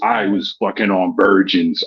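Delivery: low, slow mocking voice of the bragger; mocking imitation. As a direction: hams up the opponent's boast, slow and low. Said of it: "Mister I, I, I was".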